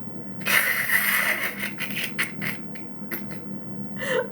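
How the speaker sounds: high, breathy, helpless squeal of laughter. Real, not acted. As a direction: let out a silent high laugh. Laughing at the story.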